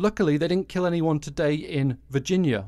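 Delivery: ordinary talk, nothing marked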